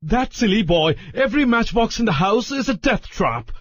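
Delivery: high, exasperated yelling; furious Larry. A narrator's reading. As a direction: yell at everyone to shut up. Larry ranting.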